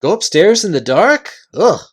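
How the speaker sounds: horrified. Acted. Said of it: "said the chief voice".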